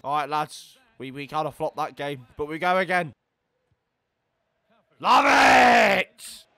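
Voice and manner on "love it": high, loud, stretched holler; exuberant shout. Real, not acted. Gamer starting the next game, exuberant shout. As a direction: belt it out.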